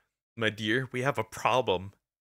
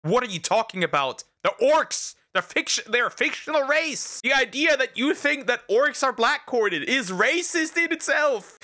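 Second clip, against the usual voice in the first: exasperated rant; 11 semitones higher than usual. Retold or imitated. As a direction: blow up in disbelief at the idea. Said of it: "And I'm like".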